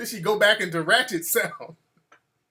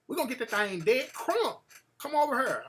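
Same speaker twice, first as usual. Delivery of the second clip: put-on country girl voice; 3 semitones higher, 6 dB quieter than usual. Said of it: "she talked real country".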